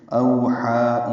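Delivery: اوحا drawn out very slowly with the yaa silent; tajweed demo. Shown as a demonstration. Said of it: "یا نہیں پڑھیں گے". (اوحا آہستہ، یا کے بغیر)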